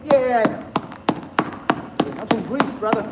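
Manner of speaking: cocky agreement. Retold or imitated. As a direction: brag it back like a proud friend. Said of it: "He's like".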